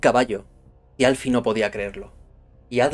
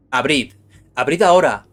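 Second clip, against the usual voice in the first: commanding shout; 3 semitones higher, 8 dB louder than usual. A narrator's reading. Stranger at the door in a storm demanding entry, commanding shout. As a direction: call out an order through a closed door.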